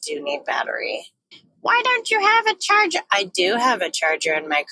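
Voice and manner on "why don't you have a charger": high, put-on voice of a nagging hater; imagined viewer heckling the vlogger, high mock whine; mock heckler. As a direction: squeak it like a snarky viewer.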